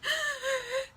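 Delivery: high-pitched mock crying gasp; hammed-up sobbing gasp.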